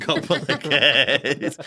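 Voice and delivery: laughing, slow and loud, echoing the punchline. Real, not acted. Laughing at a friend's joke about her sister, slow and loud. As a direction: laugh the words out.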